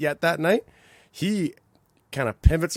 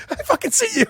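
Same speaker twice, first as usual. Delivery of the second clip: laughing callout; 8 dB louder than usual. Retold or imitated. Teller catching joker's eye.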